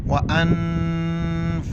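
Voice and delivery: अनफुसिकुम chanted with the first n held long in the nose; nasal ghunna. Shown as a demonstration. Teacher demonstrating sakin noon, nasal ghunna in अनफुसिकुम. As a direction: sustain a nasal hum on अन in अनफुसिकुम. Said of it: "नाक में आवाज़ पैदा करेंगे".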